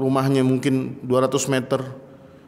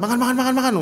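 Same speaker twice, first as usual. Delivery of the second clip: fierce food offer; 7 semitones higher, much faster than usual. Retold or imitated. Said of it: "Nawarin galak banget".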